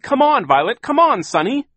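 very high, loud urging; urgent cry. A narrator's reading. Klaus urges his sisters along, crying out. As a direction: call your sisters to hurry. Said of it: "Klaus cried".

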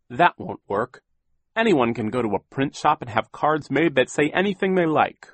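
stern official refusing excuses; Mister Poe voice. A narrator's reading. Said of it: "Mister Poe said yet again".

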